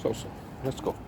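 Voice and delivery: hushed whisper to the dog; quiet sneaking-away whisper. Real, not acted. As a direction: whisper so the cats won't notice.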